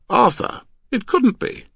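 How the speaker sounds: high, loud wifely protest; shocked disbelief. Acted. Wife doubting her husband's discovery, shocked.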